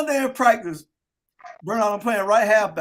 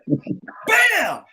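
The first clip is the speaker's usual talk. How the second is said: impact sound; 9 semitones higher than usual. Retold or imitated. Retold coach punch to belly.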